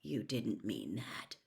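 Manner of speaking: soft, low and slow; Harry softly challenging Hermione, quiet. A narrator's reading. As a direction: say it gently, barely aloud. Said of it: "said Harry quietly".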